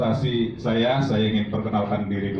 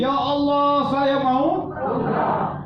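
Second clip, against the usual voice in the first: much higher, very slow imploring cry; pleading prayer; much slower than usual. Shown as a demonstration. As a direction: beg God with a drawn-out high cry. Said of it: "Yang ketiga sambil berdiri". (memohon kepada Allah dengan suara tinggi dan lambat)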